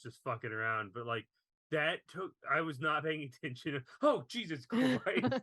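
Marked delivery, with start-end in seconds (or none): 4.0-5.3 high, loud, drawn-out mock shock; re-enacted startle. Viewer half watching hit by a jump scare, startled. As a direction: jolt as if caught off guard. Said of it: "made me jump because I was".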